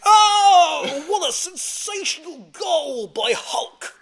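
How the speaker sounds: high, loud, slow ecstatic goal call; excited commentator. Retold or imitated. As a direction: draw it out like a thrilled commentator. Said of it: "I think it means".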